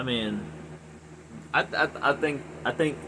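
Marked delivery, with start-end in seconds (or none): 1.5-3.0 real stammer from anger, repeating the words; stuttering. Real, not acted. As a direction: trip over the words, too angry to get them out. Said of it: "I'm fucking stuttering".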